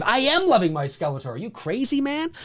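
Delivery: ordinary talk, nothing marked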